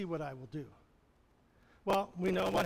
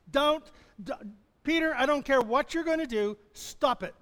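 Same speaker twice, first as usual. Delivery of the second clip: higher, louder, bossy scolding; mock scolding; 6 semitones higher, 6 dB louder than usual. Disciples in the boat shouting at Peter to stop. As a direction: tell Peter off, half laughing.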